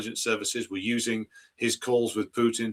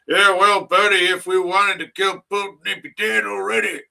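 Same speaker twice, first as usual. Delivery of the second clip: hammed-up American South accent sneering at the speaker; 9 semitones higher, 6 dB louder than usual. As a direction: play a dumb Southern good ol' boy scoffing in an American South accent. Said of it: "I'm just giving him that voice".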